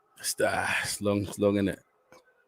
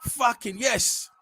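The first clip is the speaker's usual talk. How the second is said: 2 high, slow triumphant yes; 3 stretch out the victory, slow; 1 elated; 8 semitones higher, 4 dB quieter, much slower than usual. Real, not acted. 4 fan celebrating the save, high and drawn out.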